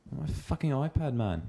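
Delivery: put-on high-pitched whine in a botched South African accent; whiny South African accent that lands Australian; attempted South African whine. Mimicking the South African friend's whine, high botched accent. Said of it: "That's Australian. That's not even South African".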